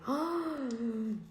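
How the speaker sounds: soft, drawn-out gasp; taken-aback gasp. Retold or imitated. Woman retelling being told she curses a lot, taken-aback gasp. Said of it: "I was so taken aback".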